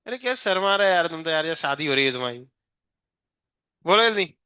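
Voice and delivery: ordinary delivery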